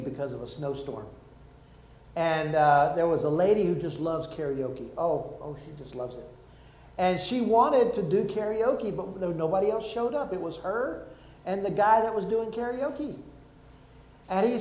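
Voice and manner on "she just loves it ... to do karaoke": ordinary talk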